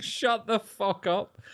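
slow, high, mock-exasperated; imitating the glaring mourners, slow and fed up; exasperated retort. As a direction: drag it out like everyone's fed up with him. Mourners glaring at tactless uncle.

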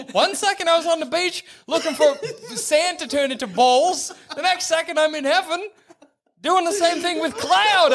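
high, baffled voice with laughter under it; high, incredulous crab lament; bewildered crab. Acted. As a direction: play a confused little crab, pitched high. Voicing bewildered crab in heaven.

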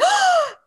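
sharp high panicked gasp on waking; shocked gasp. Retold or imitated. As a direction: gasp in sudden alarm. Waking up to find patient falling.

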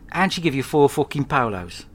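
ordinary talk, nothing marked

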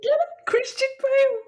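very high-pitched, eager squeal; high, gleeful nudge for a laugh; excited insistence. Real, not acted. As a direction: push the punchline in a squeaky high voice. Repeats punchline, pushing for laugh.

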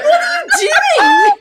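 very high, loud, laughing disbelief; incredulous mock shriek. Reacting to the terrible accent, incredulous mock shriek. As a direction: shriek the question through laughter.

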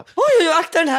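high, loud mock fretting; hammed-up worry, starting high and easing down; fussy alarm. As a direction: imitate an anxious host protecting things. Imitating a fussy, anxious host.

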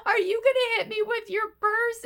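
high taunting voice; mocking taunt. Jill taunting Kathy, high mocking voice. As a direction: needle her with a high mocking tone. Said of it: "And then Jill goes".

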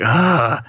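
loud tired ugh groan; a worn-out groan at careless people; weary groan. Retold or imitated. Fed up with people who don't care, a loud weary groan. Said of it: "so you're kinda like".

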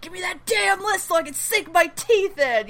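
high, strained angry demand; mock anger. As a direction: act out an angry outburst in a pushed-up voice. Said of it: "he's angry".

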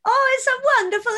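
high, gushing swoon over the name; mock Italian admirer. Friend imitating dreamy Italians hearing English names, high gushing swoon. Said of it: "they hear names like Steve Wells and go".

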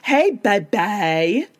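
slow, drawn-out put-on voice; exaggerated, lilting impression; mock flirty greeting. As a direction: stretch it out, imitating her entrance. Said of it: "Whitney comes over and she goes".

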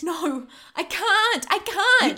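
loud, desperate refusal; panicked refusal. Acted. Forced to pick a victim.

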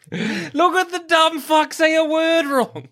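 high, slow mocking taunt; squeaky teasing jeer. Mocking friend's verbal slip.

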